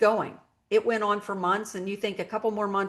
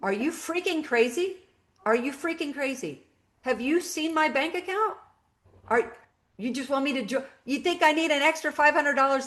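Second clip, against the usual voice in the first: higher-pitched, stern, incredulous retort; loud stern voice; 6 semitones higher than usual. Retold or imitated. To her advisor friend Todd, a stern, raised, disbelieving retort. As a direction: throw his idea back at him in disbelief. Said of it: "in a very loud, stern voice".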